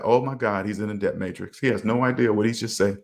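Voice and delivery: low, hushed aside in disbelief; soft, low-pitched mock dismay; appalled aside. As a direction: murmur it low, as if to yourself. Imagined appalled aside to himself.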